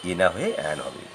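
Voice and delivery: usual speaking voice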